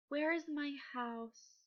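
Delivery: soft, slow, lilting question; too calm. Shown as a demonstration. Teacher showing how the bear wouldn't sound, calm. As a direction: ask it gently, without any fear. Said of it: "'Cause he's not calm".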